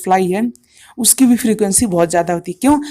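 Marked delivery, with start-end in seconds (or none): none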